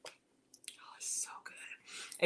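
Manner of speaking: slow, whispered relish; hushed aside over a delicious bite; savouring whisper. Real, not acted. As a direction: whisper it slowly, relishing the taste. Savouring a taste of cheese.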